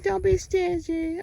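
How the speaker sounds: high, slow voice for an animal; pretend animal complaint. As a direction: speak up high for the animal, drawing it out. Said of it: "He said".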